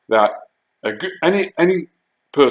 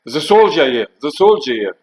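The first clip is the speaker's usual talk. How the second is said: raised, louder warning call; mock alarm call, repeated; retold shout; 4 semitones higher, 5 dB louder than usual. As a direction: imitate a woman shouting into the house. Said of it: "she shouted in to the house".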